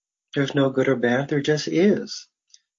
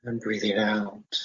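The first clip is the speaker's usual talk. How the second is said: guided meditation; 3 semitones lower than usual. Real, not acted. Faculty member leading listeners through breathing and imagery, guided meditation. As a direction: guide them into relaxation, phrase by unhurried phrase.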